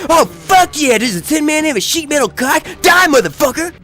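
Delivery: high, loud, greedy cheering; hungry for the fight, squeal it out; eager trigger-happy gunner. Retold or imitated. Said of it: "she's like".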